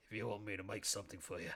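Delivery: a creepy taxidermist pig purring offers; deep voice. Pretend. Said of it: "that kind of deep".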